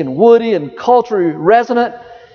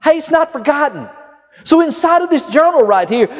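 ordinary talk

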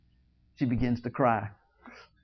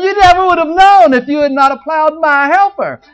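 higher and louder, a hammed-up furious shout; angry outburst; 8 semitones higher, 7 dB louder than usual. Samson, cheated, shouting at the Philistines in a mock rage. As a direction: let it burst out loud and high, indignant. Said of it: "he gets so angry".

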